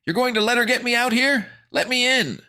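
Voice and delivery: very high, loud desperate protest; panicked shrieking appeal; pleading. A narrator's reading. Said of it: "Fancy Pants pleaded".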